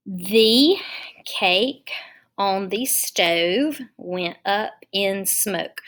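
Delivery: slow read-aloud. Shown as a demonstration. Teacher reads sentence while writing.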